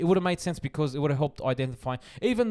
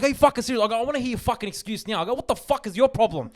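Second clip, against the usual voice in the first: high-pitched, indignant demands; heated mock confrontation, pitched up; retold angry confrontation; 8 semitones higher than usual. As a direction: replay having a go at the other driver. Replaying his confrontation with driver.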